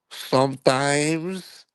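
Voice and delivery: shy drawn-out reply; coy maid voice. Retold or imitated. Maid Gloria answering the FBI agents coyly in falsetto.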